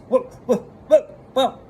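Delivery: flustered squawking. Retold or imitated. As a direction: imitate someone caught out, in high-pitched yelps. Mom caught out, imitated sputtering.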